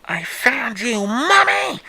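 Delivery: put-on slow then shrieking ghost child; creepy child voice. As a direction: gloat like a monster child. Dead child finds mother.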